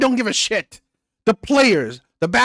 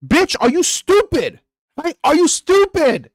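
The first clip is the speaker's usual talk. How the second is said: higher and slow, scornful disbelief; incredulous scolding; 7 semitones higher, slower than usual. Real, not acted. Scolding women who date ugly men, slow scornful disbelief. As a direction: spell out each word like she should know better.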